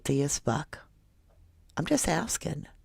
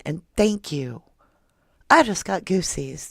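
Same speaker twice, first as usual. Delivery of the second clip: ordinary talk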